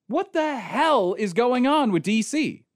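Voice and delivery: high, slow, drawn-out exasperation; utterly fed-up rhetorical demand; exasperated outburst. Real, not acted. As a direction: stretch it out in high-pitched disbelief. Exasperated outburst ending the rant.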